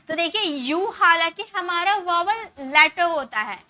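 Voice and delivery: ordinary talk, nothing marked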